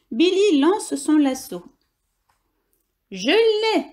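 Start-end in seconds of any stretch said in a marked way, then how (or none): 3.1-3.9 high excited exclamation; triumphant cry. Acted. Hamster cries out after lassoing the bison, thrilled. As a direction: burst out with the win. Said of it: "s'exclame Billy".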